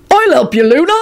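booming, high-pitched eager offer; Hagrid. A narrator's reading. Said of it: "Hagrid boomed out".

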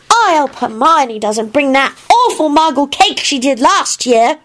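high, slow kiddie foreboding; darkly grumbling child. A narrator's reading. Teddy warns Kingsley about cake, darkly. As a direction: complain in a high child's voice, with dread. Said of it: "he added darkly".

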